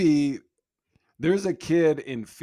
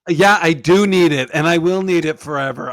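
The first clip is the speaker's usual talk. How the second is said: put-on louder, higher defiant kid; a kid proudly insisting, louder; 4 semitones higher, 10 dB louder than usual. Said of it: "she'd probably be like".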